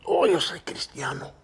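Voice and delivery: barely audible whisper; low murmur. Retold or imitated. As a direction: mumble it shyly under your breath. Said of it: "he tells me in a real low voice". (susurro tímido)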